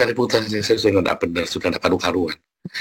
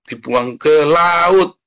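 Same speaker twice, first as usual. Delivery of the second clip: louder, higher, long drawn-out last word; dramatic storytelling; 6 semitones higher, 6 dB louder, much slower than usual. A narrator's reading. Preacher telling of Yunus thrown overboard, drawn-out drama. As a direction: stretch it out like a body flung far.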